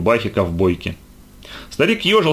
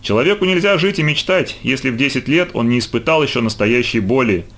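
plain reading voice

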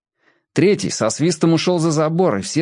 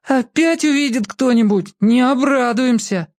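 whiny little sibling; 11 semitones higher than usual. A narrator's reading. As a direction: whimper it like a nervous kid. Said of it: "ноющим голосом сказал воробей".

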